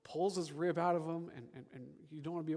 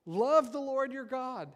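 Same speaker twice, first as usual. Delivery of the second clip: high, loud scripture proclamation; exclamatory command; 7 semitones higher, 6 dB louder than usual. Acted. Preacher reading Joshua's command to the congregation, loud exclamation. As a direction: deliver it with a spoken exclamation point. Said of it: "exclamation point".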